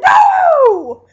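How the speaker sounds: very loud, high, drawn-out no; screamed protest. Retold or imitated. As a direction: howl it as an over-the-top fan reaction. Viewers' reaction to commercial break.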